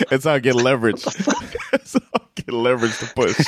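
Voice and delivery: talking through laughter; laughing. Real, not acted. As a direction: crack up while getting the line out. Cracking up while riffing on a quote.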